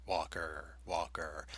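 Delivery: like a voice bouncing off canyon walls; echo effect. Acted. Echo on the title.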